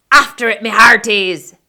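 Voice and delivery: loud rallying order; pirate command. Acted. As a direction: command the crew to dive in. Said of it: "Braidbeard commanded".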